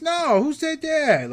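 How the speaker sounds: high, slow, drawn-out mock innocence; defensive denial. Seller asked if he stole toys, exaggerated denial. Acting seller denying theft.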